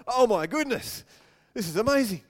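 loud delighted gasp of wonder; thrilled as the dog fetches; amazed. Pretend. Owner watching dog fetch on command, loud amazement. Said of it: "You're like".

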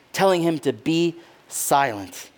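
ordinary talk, nothing marked

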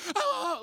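high, loud yelps of pain; acted repeated shrieks; mock pain scream. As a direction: cry out as if hit at close range. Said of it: "You're just screaming in pain".

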